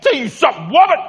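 mock-angry outburst climbing onto WOMAN; mad at his wife. Husband fed up with his wife's backseat driving, mad. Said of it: "I got mad".

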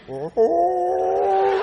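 long, high held "oh"; dying chicken cry. Retold or imitated. Chicken pinned under grandpa's foot, long last cry. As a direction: imitate the chicken's last sustained squawk. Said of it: "that chicken went".

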